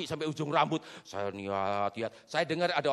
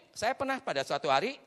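normal talking voice

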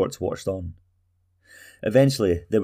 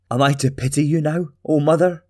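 higher and louder, sneering; scornful retort; 7 semitones higher, 5 dB louder than usual. Acted. Talgo sneers at his mother's plea, higher and louder. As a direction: throw the question back at her.

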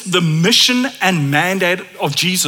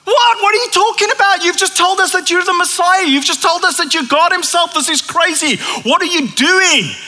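high, incredulous "What?" cries; the disciples can't believe what they're hearing; stunned disbelief; 10 semitones higher than usual. Retold or imitated. Disciples react to Jesus's prediction, shocked and high-pitched. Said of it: "And they're like".

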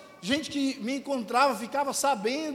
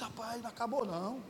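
quiet self-reassurance; 3 semitones lower, 10 dB quieter than usual. Retold or imitated. Retells telling himself it isn't over.

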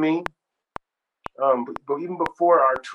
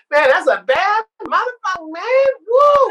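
very high, loud hyped-up praise; thrilled whoop; 9 dB louder than usual. Retold or imitated. Stevie raves about the singer, thrilled. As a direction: cheer wildly, ending in a whoop.

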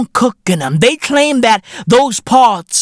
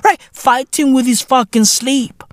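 ordinary delivery

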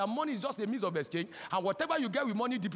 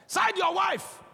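emphatic shout; 7 semitones higher, 6 dB louder, much slower than usual. Acted. Preacher contrasts siding mother vs wife.

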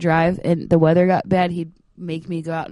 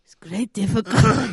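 louder put-on Italian accent; dad's Italian accent; 5 dB louder than usual. Imitating dad over the great difficulty, Italian accent. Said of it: "it's my dad".

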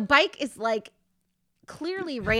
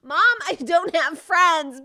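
loud, high, drawn-out wail to mom; yelled child's protest; 4 semitones higher, 7 dB louder, slower than usual. Acted. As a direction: yell it slowly like a wronged kid. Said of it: "I yelled that at her".

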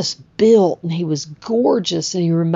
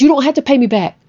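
insistent reassurance; 8 semitones higher, 4 dB louder, much faster than usual. Retold or imitated. Friend waves off repayment.

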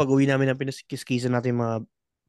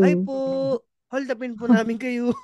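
polite robber; 6 semitones higher than usual. Retold or imitated. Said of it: "mga professional".

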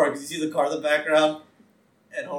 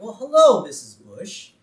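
sing-song greeting; 6 semitones higher, 6 dB louder, slower than usual. Acted. Bart greets Missus Bush outside, sing-song cheeky. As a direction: greet her like a cheeky kid.

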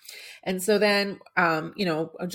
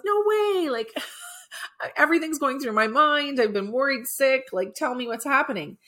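higher, urgent protest, retold; exasperated acted plea, pitched up; worried insistence; 7 semitones higher, 3 dB louder than usual. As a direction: press them anxiously to spill the news. Acted demand of a worried friend.

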